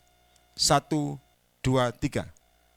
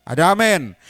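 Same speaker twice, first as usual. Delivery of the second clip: 1 calling out; 8 dB louder than usual. Real, not acted. Calling for congregation's amen.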